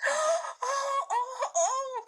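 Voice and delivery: high wailing cries; crying out. A narrator's reading. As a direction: wail in dismay. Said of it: "cried the two girls".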